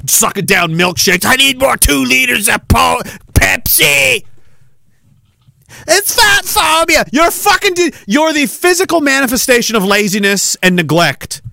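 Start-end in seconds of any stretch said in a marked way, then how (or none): 1.3-4.2 hammed-up greedy whine; mock whiny glutton. Impersonating obese person. 5.9-10.6 high, put-on mocking voice; sneering mock of a critic; mocking shrill voice. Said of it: "mocking opponents".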